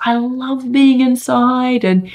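high, slow imitation of a delighted child; happy child. Mother retelling her son enjoying being inside, happy. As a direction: gush lightly like a happy kid, drawn out and high.

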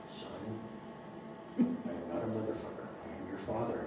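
soft, unhurried calm fatherly reply; low, slow response. Retold or imitated. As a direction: say it slowly and softly, unbothered. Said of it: "Mark's low, slow response".

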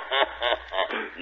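a brief hearty chuckle; short laugh. Acted. Popeye laughing at Olive's alarm, short chuckle. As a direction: laugh it off good-naturedly.

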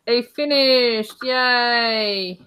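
long, held celebratory cry; drawn-out cheer. Real, not acted. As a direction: cheer the finish, stretching each word. Cheering that someone finished.

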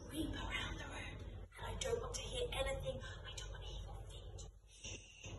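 a hushed, breathless hush for the sneaking game; whispered creep. Shown as a demonstration. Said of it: "if I say the word snake".

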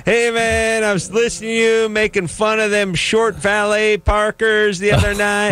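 lightly voiced fan gushing over the show; imitating the store guy. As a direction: play the excited fan at the counter. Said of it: "the guy behind the counter is like".

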